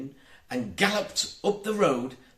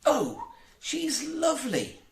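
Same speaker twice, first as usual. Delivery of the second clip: much higher, breathless with delight; delighted cry; 14 semitones higher than usual. A narrator's reading. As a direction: gasp at the pretty fairy, high and thrilled. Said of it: "Wendy cried".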